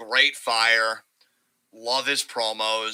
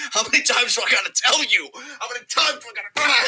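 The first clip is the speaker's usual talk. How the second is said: Roman's fury; 9 semitones higher, faster than usual. Retold or imitated. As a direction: berate him between chair shots. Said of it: "he's like".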